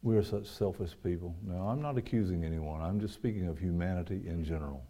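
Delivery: usual speaking voice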